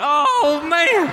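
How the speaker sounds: high, crushed "oh man"; dismayed. Retold or imitated. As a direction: let it drop like a let-down fan. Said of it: "I went".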